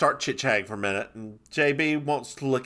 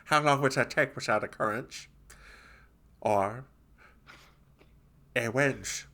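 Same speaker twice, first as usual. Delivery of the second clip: quiet puzzled detective voice; character voice; 5 dB quieter than usual. Acted. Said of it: "So J B says".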